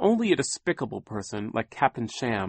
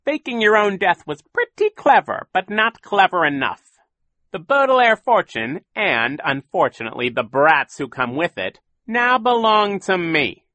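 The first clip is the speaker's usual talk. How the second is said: gloating villain; 10 semitones higher, 10 dB louder than usual. A narrator's reading. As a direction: savour every word of the win. Captain Sham gloating.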